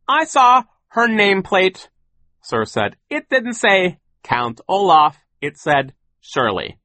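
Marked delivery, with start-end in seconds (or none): 0.1-1.9 slow, loud, high assertion; blustering, deliberate retort; insistent boss. A narrator's reading. Sir insists to Violet. 3.1-6.8 slow, loud, emphatic rebuttal; high, deliberate bluster; insistent boss. A narrator's reading. Sir insists to Violet.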